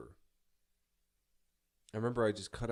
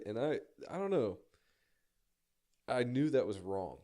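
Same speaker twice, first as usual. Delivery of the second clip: ordinary talk, nothing marked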